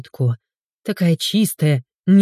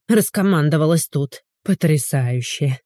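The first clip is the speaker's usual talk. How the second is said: ordinary delivery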